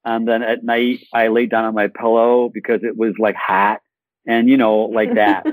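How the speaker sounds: exaggerated Michigan vowels; Michigan accent. Shown as a demonstration. Actor recalling milk and pillow at home, Michigan accent. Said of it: "I'll channel some of it".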